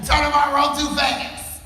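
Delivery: very high mock squeal; squealed complaint. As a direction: whine it high like a flustered rope jumper. Acting a double-dutch jumper's complaint.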